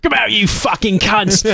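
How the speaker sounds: high-pitched hammed-up British soldier's shout; mock British battle cry. British soldier charging at the SS, hammed-up high battle shout. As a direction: yell it like a brawler in a British accent. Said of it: "do the accents from this film".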